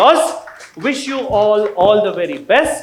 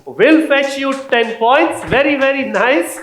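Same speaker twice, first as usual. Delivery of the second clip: excited praise; 6 semitones higher, 5 dB louder than usual. Real, not acted. Quizmaster praises correct answer.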